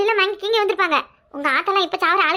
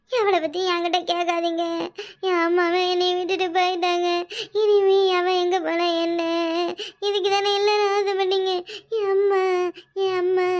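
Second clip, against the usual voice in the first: non-stop crying. Pretend. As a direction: keep sobbing through every word. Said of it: "மூச்சு விடாம அழுதுகிட்டு இருக்கிறதுக்கு". (விடாமல் அழும் போலி புலம்பல்)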